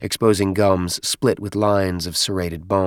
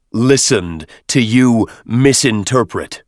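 slow, loud, echoing oracle voice; deep reverberating voice; 2 semitones higher, 5 dB louder, slower than usual. Acted. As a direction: boom it out like an ancient machine. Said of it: "The oracle's deep voice reverberated".

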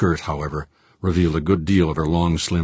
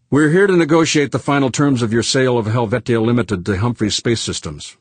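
higher, louder businesswoman voice; crisp, all business; 6 semitones higher, 4 dB louder than usual. A narrator's reading. Executive opening a sale negotiation, crisp and businesslike. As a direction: state the terms crisply, pitched up. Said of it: "Vervoort said crisply, all business".

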